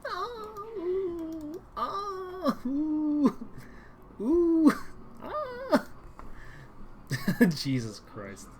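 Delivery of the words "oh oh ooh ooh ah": high squealing swoon; swooning moans. Acted. Swooning over the handsome rescuer, high squeals. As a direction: melt with exaggerated swooning.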